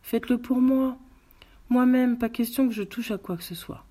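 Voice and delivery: the widow insists, pushing the detectives to take the lamb; urgent pleading. A narrator's reading. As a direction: press them hard to eat. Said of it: "supplia-t-elle".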